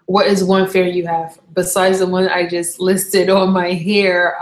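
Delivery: ordinary delivery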